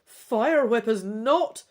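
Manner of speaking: narrating the dragon's surprise attack; dramatic story narration. A narrator's reading. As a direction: read the cliffhanger with rising drama. Climactic narration.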